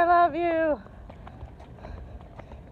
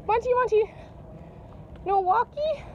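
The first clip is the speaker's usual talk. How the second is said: higher pleading sing-song; affectionate calling; 5 semitones higher than usual. Real, not acted. Owner pleading for affection from dog Monty, higher sing-song. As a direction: beg the dog for love. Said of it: "calling affectionately".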